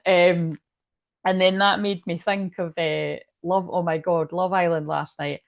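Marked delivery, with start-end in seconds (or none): none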